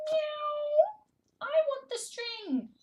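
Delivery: high squeaky little cat. Pretend. Reading to toddler, high kitten voice. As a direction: speak as a small eager kitten. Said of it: "cat voice".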